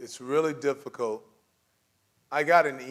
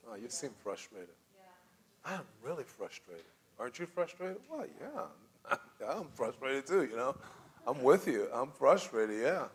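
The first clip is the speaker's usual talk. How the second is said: gentle demo; 6 dB quieter than usual. Shown as a demonstration. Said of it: "just reflect what they might be feeling".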